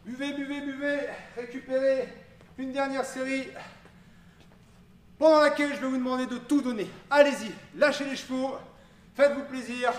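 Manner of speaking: rally the class at full voice; loud hype shout. Acted. Coach fires up the final set.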